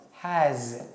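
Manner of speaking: "has" in its full strong form, with a full vowel. Shown as a demonstration. Teacher showing students the strong form of "has", slowly and clearly. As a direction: give "has" its full stressed form, not the reduced one. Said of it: "this is the strong form".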